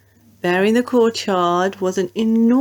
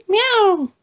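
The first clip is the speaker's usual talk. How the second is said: high mewing cat voice; a small worried meow; 11 semitones higher than usual. A narrator's reading. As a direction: mew it like a timid cat. Wilbur the cat answers.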